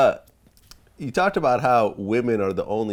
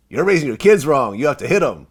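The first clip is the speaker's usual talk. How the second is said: loud, raised-pitch telling-off; stern scolding; 5 semitones higher, 8 dB louder than usual. Retold or imitated. Tattooed guy telling parents how to raise kids, stern scolding. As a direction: lay down the law at a parent.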